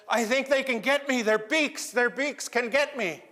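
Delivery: high, loud, scared whimpering squeak; frightened little creature. Acted. Hidden eye creature frets to Raven about the beaks, high scared squeak. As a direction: cower and fret in a tiny voice.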